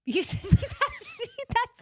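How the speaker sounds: laughing. Real, not acted. Laughing at pronunciation.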